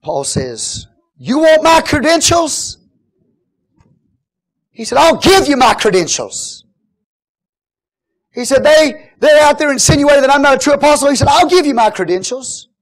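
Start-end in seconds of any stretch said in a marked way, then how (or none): 1.1-2.8 high, loud, drawn-out challenge; indignant challenge. Acted. Paul answering his doubters, indignant shout. 5.0-6.6 defiant answer. Acted. Paul answering his accusers, defiant shout. 8.6-12.7 high, loud preacher's retort; indignant retort. Acted. Paul rebutting those who doubt his apostleship, indignant shout.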